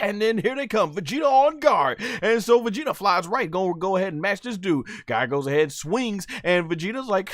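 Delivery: high, excited fight commentary; live play-by-play. Acted. Fan narrating an anime fight to viewers, hyped play-by-play. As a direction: call the action like a ringside announcer.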